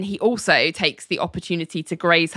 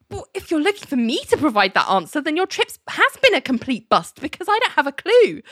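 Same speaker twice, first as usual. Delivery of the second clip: spluttering John; 8 semitones higher, faster than usual. Retold or imitated. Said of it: "John sort of splutters".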